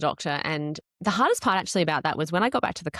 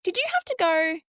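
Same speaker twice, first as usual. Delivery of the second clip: anxious question; 8 semitones higher than usual. Retold or imitated. Anxious message to husband retold.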